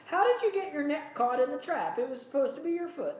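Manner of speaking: high-pitched, exaggerated character voice with an accent; killer's put-on accent. A narrator's reading. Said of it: "I don't know why his accent".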